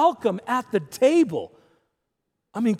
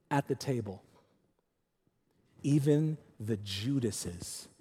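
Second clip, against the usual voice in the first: much softer and lower, slowed right down; hushed emphasis; 10 semitones lower, 10 dB quieter, slower than usual. Real, not acted. Preacher to congregation, dropping to a slow hush. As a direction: let it land in a near hush.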